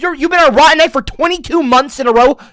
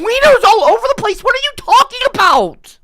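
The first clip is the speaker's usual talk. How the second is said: high, louder disbelieving question; incredulous; 12 semitones higher, 4 dB louder than usual. Real, not acted. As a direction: ask it in disbelief, pitch way up. Incredulous at spam.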